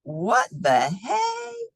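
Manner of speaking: slow, drawn-out astonished question; surprised. Acted. Rancher stunned by all Elna built, slow and amazed. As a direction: stretch it out in disbelief. Rancher sees Elna's buildings.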